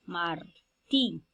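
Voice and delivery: "martí" split in two, pausing before "tí"; syllable by syllable. Shown as a demonstration. Teacher showing students the tonic syllable, "martí" split into syllables. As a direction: break "martí" into "mar" and "tí", unhurried. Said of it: "ahí está la mayor elevación de la voz".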